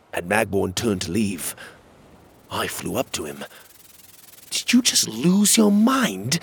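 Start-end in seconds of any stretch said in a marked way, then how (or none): 4.5-6.4 high-pitched, slow, louder question; incredulous but sincere. A narrator's reading. Narrator asking Magborn after the nonsense exchange, sincerely baffled. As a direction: ask in baffled earnest, pitch way up. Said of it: "I asked sincerely".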